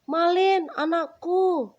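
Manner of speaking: high, loud call across a distance; calling from afar. A narrator's reading. Mother calling her son from afar, loud. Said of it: "kata ibunya dari jauh".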